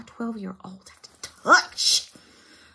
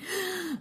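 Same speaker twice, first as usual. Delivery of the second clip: startled gasp; 12 semitones higher, 7 dB quieter than usual. Real, not acted. Static jumps on screen.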